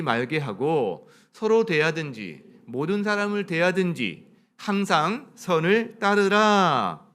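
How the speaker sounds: loud, slow, measured congregational reading; scripture reading aloud. Acted. Pastor leads the congregation in reading the verse, loud slow recitation. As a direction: read it out slowly and firmly for all to follow.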